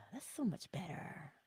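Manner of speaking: whispered, relieved sigh of calm. Retold or imitated. Someone calmed down after assessing, relieved whisper. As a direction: let the tension go in a breath.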